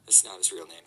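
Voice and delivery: ordinary talk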